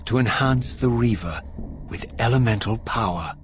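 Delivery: measured, brooding storyteller's voice; game narration. A narrator's reading. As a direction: recount it like a dark legend. Raziel narrates between cutscenes.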